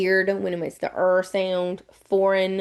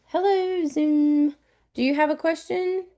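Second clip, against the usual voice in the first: much higher, sing-song hail; high-pitched calling; 7 semitones higher than usual. Real, not acted. Teacher checking on a waving Zoom student, high sing-song call. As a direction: call out lightly to someone online.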